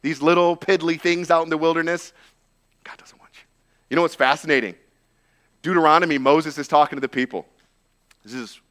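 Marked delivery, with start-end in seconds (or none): 2.8-3.4 breathy voiceless whisper. Shown as a demonstration. Shame whispers to a believer, voiceless whisper. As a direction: hiss it right into their ear. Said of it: "Shame whispers in your ear".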